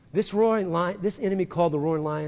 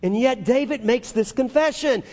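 usual speaking voice

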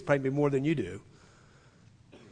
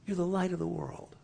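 tender reminder; 2 semitones higher, 5 dB quieter than usual. Acted. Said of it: "please remember who you are".

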